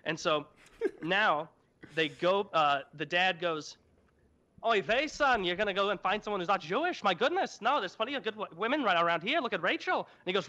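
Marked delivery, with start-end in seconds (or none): 4.7-10.0 hint of an old Yiddish father; Yiddish accent. Dad voiced in retold Bible story.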